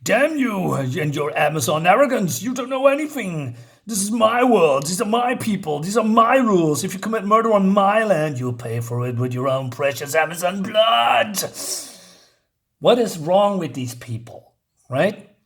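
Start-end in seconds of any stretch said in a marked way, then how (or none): 0.0-11.4 hammed-up indignant outburst building to the last word; angry Superman. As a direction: hammer each claim with rising fury. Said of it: "Superman is getting angry".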